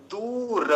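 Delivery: दूर drawn out with a full "ra" ending; full final र. Shown as a demonstration. Teacher correcting students on दूर, full final र. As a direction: stretch दू high, then voice the र of दूर fully. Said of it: "र को पूरा बोलेंगे".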